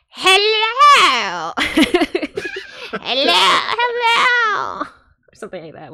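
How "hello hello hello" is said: very high, slow squeaky bug voice; cockroach voice. Acted. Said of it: "my cockroach voice is like".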